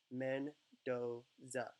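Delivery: Mendoza split slowly into three separate syllables. Shown as a demonstration. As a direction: draw out each syllable of Mendoza with small gaps. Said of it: "Three different formations of the mouth".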